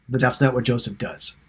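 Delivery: normal talking voice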